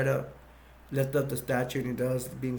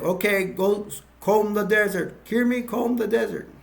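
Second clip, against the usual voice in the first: barked order; 6 semitones higher than usual. Retold or imitated. President Screw orders search.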